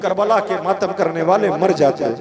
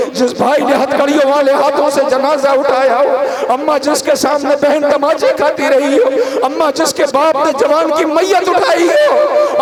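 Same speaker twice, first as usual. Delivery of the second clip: sobbing lament; 8 semitones higher, 6 dB louder than usual. Acted. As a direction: voice climbs higher and louder through the list, breaking into sobs. (माँ से रोते हुए बढ़ता विलाप)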